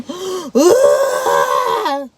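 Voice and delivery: imitated sobbing gasp, rising; crying gasp. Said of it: "He's crying".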